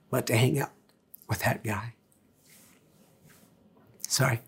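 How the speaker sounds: real choked-back tears, voice dropping away softly; soft, halting, fighting back tears; tearing up. Real, not acted. Preacher convicted about the man he drove past, tearing up. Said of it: "I'm kinda tearing up a little bit".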